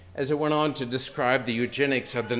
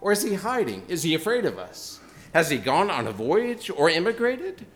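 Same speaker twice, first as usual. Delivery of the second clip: raised, higher jeering questions; mocking shout; 6 semitones higher, 5 dB louder than usual. A narrator's reading. Unbelievers in the marketplace mocking the madman, jeering. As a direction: tease the madman as a crowd would. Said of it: "They shouted and laughed".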